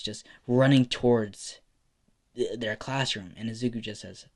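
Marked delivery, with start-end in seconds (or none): none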